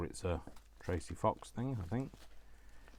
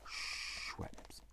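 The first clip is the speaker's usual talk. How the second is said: drawn-out whisper; 8 dB quieter than usual. Real, not acted. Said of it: "Don't know why I'm having to say that".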